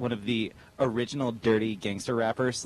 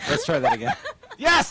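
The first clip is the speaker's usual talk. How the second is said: high, loud laugh with a snort; laughing through a snort; 6 dB louder than usual. Real, not acted. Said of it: "there's the snort".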